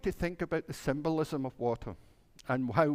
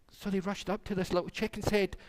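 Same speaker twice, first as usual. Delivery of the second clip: ordinary delivery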